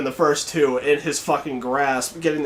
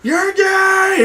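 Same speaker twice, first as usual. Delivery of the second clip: high, loud group shout; taunting shout, pitched way up; screamed taunt; 13 semitones higher, 7 dB louder, much slower than usual. Retold or imitated. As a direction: yell it across the playground. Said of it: "We all screamed".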